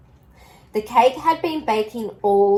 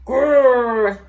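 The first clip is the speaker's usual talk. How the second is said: louder rolling growl; dog growl; 6 dB louder than usual. A narrator's reading. Bob the dog growls at the pelican. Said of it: "growled Bob".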